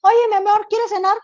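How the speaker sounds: agudo y cariñoso; esposo imitado. Retold or imitated. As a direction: pregunta con cariño. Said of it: "ahorita ya es meme". (esposo invitando a cenar, voz aguda cariñosa)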